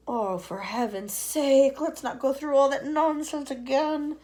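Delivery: fed up with the fuss; exasperated earthworm. Acted. Earthworm exasperated with the centipede's boots.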